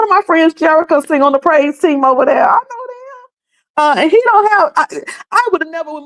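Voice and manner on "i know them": drawn-out high mock "I know them"; mock slow. Repeating her reply slowly and high. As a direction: stretch it way up and slow.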